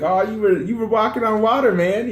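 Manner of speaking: high, teasing voice of his friend; joking friend. Retold or imitated. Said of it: "Louis kinda jokes about it. He's like".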